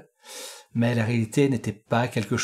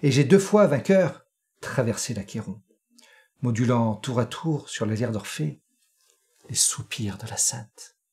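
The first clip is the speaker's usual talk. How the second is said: récitation de poème; 4 semitones lower, 4 dB quieter than usual. Acted. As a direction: recite it low and hushed, lifting at key turns. (récitation poétique, lente, grave et posée)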